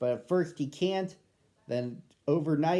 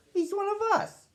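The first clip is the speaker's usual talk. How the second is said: much higher, put-on dog voice for the pack. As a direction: squeak it out as the welcoming dogs. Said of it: "Then at last I heard them say".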